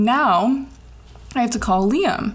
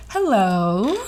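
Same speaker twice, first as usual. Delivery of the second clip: a hint of a sing-song cheerful mom; cheery mom greeting. Mom answering the phone, lightly cheery. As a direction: lightly chirp it like a happy mom. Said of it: "happy and cheery".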